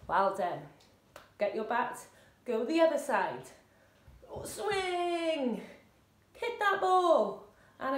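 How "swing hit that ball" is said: high, drawn-out sporty call; swinging call. Shown as a demonstration. Coach calling the bat swing, high and drawn out. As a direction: stretch each move out high.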